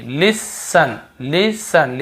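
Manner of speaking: "listen" said slowly with no T sound, "lis-sun"; silent T. Shown as a demonstration. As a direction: drop the T in "listen", drawing out the syllables. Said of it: "यहाँ पे टी को नहीं पढ़". ("listen" में टी silent, धीरे-धीरे)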